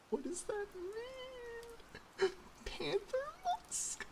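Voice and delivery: high, slow incredulous squeak; shrill disbelief. Real, not acted. Baffled high-pitched reaction.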